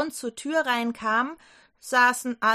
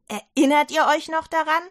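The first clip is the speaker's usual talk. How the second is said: higher, louder urgent question; eager question; 4 semitones higher, 6 dB louder than usual. Acted. Maria urges the doubting disciples, higher and louder. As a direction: press them to remember.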